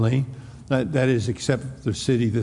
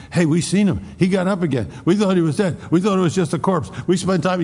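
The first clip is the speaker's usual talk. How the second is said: higher and brighter, eager witness blurting news; excited retelling; 6 semitones higher, faster than usual. Retold or imitated. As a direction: burst out like you just saw him alive.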